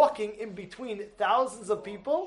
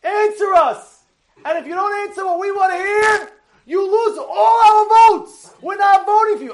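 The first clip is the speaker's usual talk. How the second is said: high, loud retold yell; screaming protester; 10 semitones higher, 9 dB louder than usual. Protester screaming at the candidate for an answer, loud and high. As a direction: yell out as an angry rally-goer, stretching key words. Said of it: "one of the people scream out".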